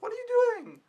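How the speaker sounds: high mock intrusive voice; nosy relative. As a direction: mimic someone barging in. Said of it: "someone coming in and be like".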